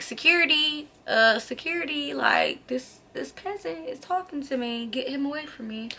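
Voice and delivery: high, soft mock-haughty complaint; haughty appeal to security. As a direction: act too grand to deal with him, high and hushed.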